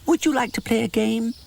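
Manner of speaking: eager little mouse inviting a friend; mouse calling. A narrator's reading. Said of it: "Raistus the mouse call his name".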